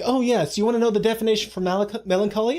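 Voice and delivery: high, quick, sing-out parody of an obliging expert; mock helpfulness. Imagined dictionary answering a question, high mock-helpful patter. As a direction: play the eager know-it-all. Mocking an imagined definition giver.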